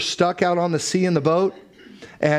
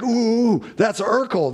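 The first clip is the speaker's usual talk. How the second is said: excited; 8 semitones higher, slower than usual. Retold or imitated. Caller excitedly guesses the answer.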